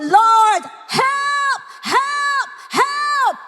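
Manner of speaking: high, long repeated cries for rescue; crying-out shout. Acted. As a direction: shout each plea up to heaven. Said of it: "umiyak ka nang sumisigaw". (pasigaw na pagsusumamo sa Diyos)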